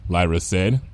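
ordinary delivery

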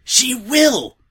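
high, loud furious roar. A narrator's reading. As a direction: bellow it in denial. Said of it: "roared Twilight".

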